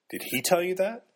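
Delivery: shocked outburst. A narrator's reading. Malcolm learns Nan read his novel, shocked and indignant. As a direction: caught off guard and indignant.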